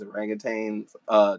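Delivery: ordinary talk, nothing marked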